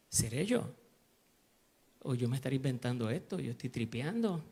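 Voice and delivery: low, softened musing to himself; quiet inner questioning; retold self-doubt. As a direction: wonder privately, voice dropped. Retells his private self-doubt.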